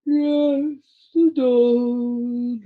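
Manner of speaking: slow, sleepy drawl through a yawn; a drowsy creature yawning mid-word. A narrator's reading. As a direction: yawn the words out lazily. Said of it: "yawned another voice".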